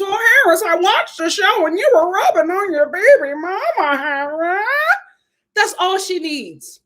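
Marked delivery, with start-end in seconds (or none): 0.0-4.7 higher mock whine; a hint of a jealous woman; mocking Stephanie's coming complaint; imitating Stephanie. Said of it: "That's all that nut job needs".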